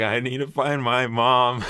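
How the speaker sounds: put-on slow, high tearful child's voice; mock tearful kid. Lost kid asking the cashier for mom, mock tearful. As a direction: squeeze it out like a crying little kid. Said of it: "tears in my eyes".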